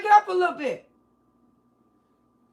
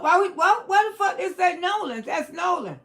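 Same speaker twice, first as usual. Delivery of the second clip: ordinary talk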